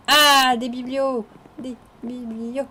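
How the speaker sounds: loud, high delighted cry; thrilled discovery shout; excited find. Real, not acted. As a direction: burst out with glee at the find. Finds bookshelves in village.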